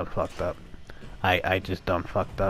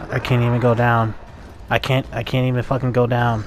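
frustrated complaint; 3 semitones higher, 7 dB louder than usual. Real, not acted. Frustrated at game.

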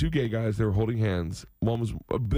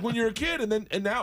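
usual speaking voice

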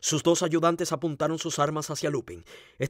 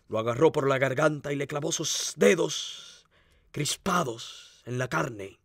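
usual speaking voice

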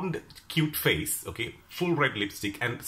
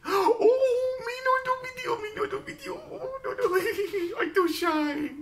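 put-on very high, giggling Chinese accent; shy laughing Chinese accent; 5 dB louder than usual. Stall owner shyly refusing the camera, high giggling Chinese accent. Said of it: "that strong Chinese accent".

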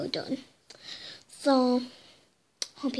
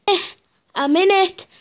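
excited; 5 semitones higher, 7 dB louder than usual. Real, not acted. Squeezed into the small space.

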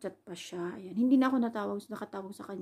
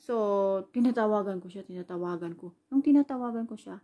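ordinary talk, nothing marked

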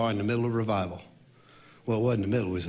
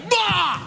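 high, loud bellowed blast; triumphant shout; 6 dB louder than usual. Retold or imitated. Worship leader giving the song everything, shouting. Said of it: "I did it with everything".

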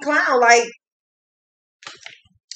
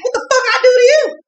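much higher, fast, indignant yell; acted angry outburst; 12 semitones higher, 4 dB louder, faster than usual. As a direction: fire it back like he's been wronged. Voicing the angry man.

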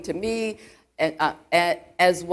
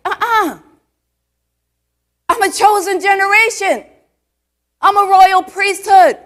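declaring faith; 11 semitones higher, 10 dB louder than usual. Retold or imitated. Retelling her vow to herself, bold loud declaration. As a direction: proclaim it like a battle cry. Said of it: "And I says".

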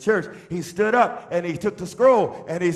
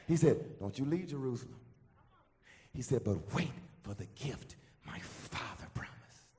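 very soft, low, near-whispered sermon; hushed preaching; 7 semitones lower, 13 dB quieter than usual. Real, not acted. Preacher passing on Jesus's command to wait, hushed preaching. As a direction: lower it almost to a whisper.

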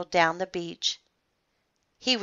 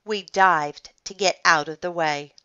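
usual speaking voice

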